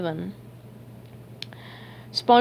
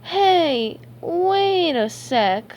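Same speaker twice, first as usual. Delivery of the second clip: slow, high, dawning suspicion; stretched-out cartoon voice, pitched up; slowly; 8 semitones higher, much slower than usual. A narrator's reading. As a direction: draw each word right out. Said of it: "SpongeBob said slowly".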